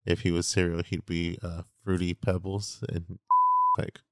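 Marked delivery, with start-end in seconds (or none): 3.2-3.7 very high, squealing burst of laughter; a real squeal of laughter at a funny memory. Real, not acted. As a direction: shriek with laughter. Recalling funny moment.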